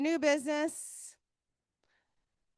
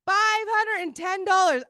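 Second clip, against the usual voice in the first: proud, louder announcement; 5 semitones higher, 6 dB louder than usual. Real, not acted. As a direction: announce the total to the room. Announcing raffle total.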